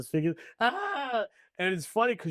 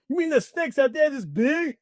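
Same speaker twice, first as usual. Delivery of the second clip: a much higher, louder mock-astonished delivery; 11 semitones higher, 5 dB louder than usual. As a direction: ham up the stock movie reaction. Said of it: "And he's like".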